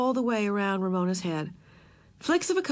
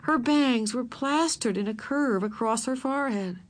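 plain reading voice

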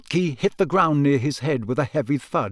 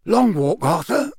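higher, friendly questioning voice; hearty Amos Diggory; 7 semitones higher than usual. Acted. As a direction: ask like a hearty older friend.

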